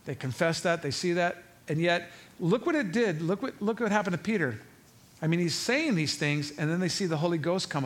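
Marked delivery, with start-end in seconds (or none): none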